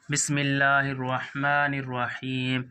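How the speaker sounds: slow measured Quranic recitation. Shown as a demonstration. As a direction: intone it slowly like tilawat. Recites basmala in Quranic style.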